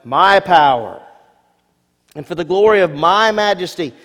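boastful king. Acted. Preacher voicing boastful king.

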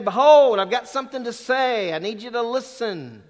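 loud, high commanding "Behold"; attention call. Shown as a demonstration. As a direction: call the crowd to listen. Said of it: "that's the idea".

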